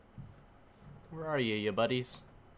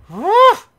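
high, loud whoop; short burst that shoots up high; startled yelp; 19 dB louder than usual. Real, not acted. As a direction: yelp out suddenly. Startled noise during play.

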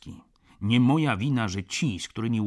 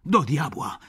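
louder, higher exclamation; emphatic curse; 5 semitones higher, 8 dB louder than usual. Acted. Friend slamming his glass, cursing loudly at Stach. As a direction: bang the point down.

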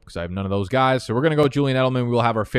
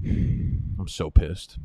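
sighed whisper; 5 semitones lower, much slower than usual. Real, not acted. After missing picks.

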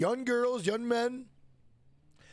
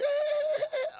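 high-pitched fake wailing; mock crying. Streamer acting out a stranger on Omegle, high mock crying. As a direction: imitate someone bursting into sobs, pitched way up. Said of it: "she just starts crying".

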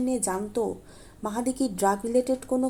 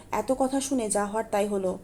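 ordinary delivery, nothing marked

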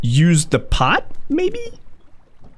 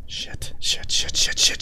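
whispered string of curses; frantic whispered swearing; panicked whisper; 7 dB quieter than usual. Real, not acted. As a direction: hiss the curses under your breath in panic. Panicked muttering under breath.

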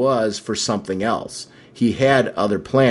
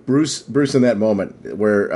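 ordinary delivery

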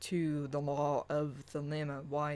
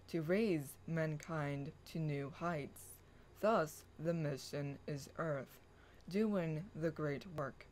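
hushed affirmation reading; incantatory reading; 5 dB quieter than usual. Acted. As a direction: read it like a quiet invocation. Reads her fantasy blog passage.

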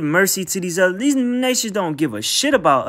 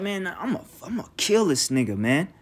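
muttered threat; 2 semitones lower, 7 dB quieter than usual. Retold or imitated. Acting out Esau's private vow to kill.

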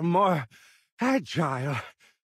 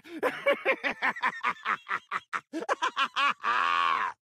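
long evil cackle, high and wild; maniacal cackle; 8 semitones higher than usual. Acted. Captured boss cackles at his captors, maniacal cackle. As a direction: cackle like a madman. Villain's mocking laughter.

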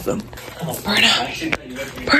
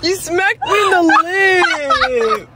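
laughing complaint; 6 semitones higher, much slower than usual. Real, not acted. Friend hit her in car.

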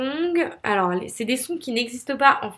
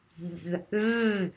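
softer drawn-out buzzing like a Z; voiced th buzz; 3 semitones lower, 7 dB quieter than usual. Shown as a demonstration. Teacher isolating the vibrating th, a held buzz. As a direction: hold a buzz on the tongue tip. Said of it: "C'est comme un Z".

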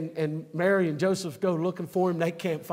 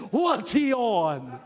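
slow, shouted mock question; mock puzzled coworker; 5 semitones higher, 5 dB louder, much slower than usual. Coworkers wondering about a glad believer, slow mock shout. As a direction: call it out slow and loud like a baffled coworker. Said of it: "people are gonna say".

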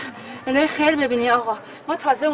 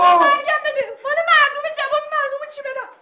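desperate plea; 10 semitones higher, 3 dB louder than usual. Acted. Mother pleads over neighbours' food.

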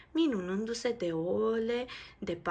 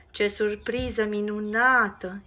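louder happy exclamation; delighted exclaim; 8 dB louder than usual. Acted. Nico sees the eggs in the nest, delighted. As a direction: gasp with joy. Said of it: "exclamă Nico".

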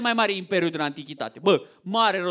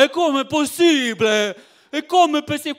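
crying Italian; 5 semitones higher than usual. Retold or imitated. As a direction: whine it like a duped old Italian. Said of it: "cum să plânge ăla".

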